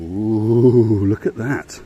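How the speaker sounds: loud, delighted ooh; thrilled to see it; excited. Real, not acted. First sight of spa.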